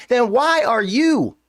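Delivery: high, drawn-out exasperated challenge; triggered. Real, not acted. Host challenging fans who changed their expectations, exasperated. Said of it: "I get triggered by it".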